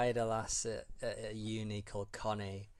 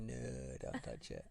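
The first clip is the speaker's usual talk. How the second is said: lightly imitated Hull accent, softer; a hint of Hull on 'No'; understated Hull impression; 10 semitones lower, 7 dB quieter, slower than usual. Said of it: "and she'd always say".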